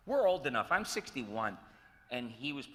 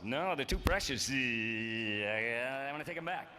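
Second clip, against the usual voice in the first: mock announcer clinging to his words, a touch louder; hammed-up announcer; 5 dB louder, slower than usual. Teasing announcers who won't let words go, mock pompous. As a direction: hold each word like it's precious. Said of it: "wouldn't let the words go".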